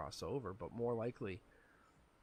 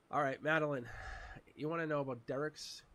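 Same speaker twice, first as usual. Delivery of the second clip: usual speaking voice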